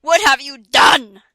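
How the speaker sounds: loud and slow; cry out. A narrator's reading. A grieving man cries out at her. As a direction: demand it loudly, slowly. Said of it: "He started to cry".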